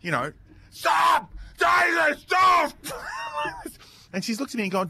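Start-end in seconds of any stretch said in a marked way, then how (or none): 0.7-4.1 high, loud panicked parent's yell; re-enacted full-voice shout. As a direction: yell at a learner driver to stop. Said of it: "you have to yell at them".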